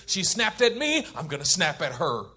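indignant retort, set on payback; wounded heart. Acted. The wounded heart vowing to get back at her, indignant. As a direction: play the wounded, vengeful heart. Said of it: "That's the wounded heart".

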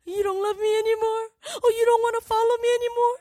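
high, put-on needy whine; mock insecure leader. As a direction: whine for reassurance, hammed up. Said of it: "When you're insecure as a leader".

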